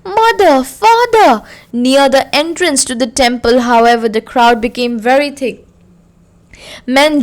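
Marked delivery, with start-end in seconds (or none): 0.0-1.4 put-on high child's call with a sob in it; a lost boy calling through his sobs; high sobbing child's call. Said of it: "his little sob lingering".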